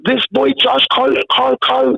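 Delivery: lightly voiced mom calling her son to the phone; mom yelling. Lebanese mom calls her son to the phone, in a mild mock yell. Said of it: "like, yelling at me".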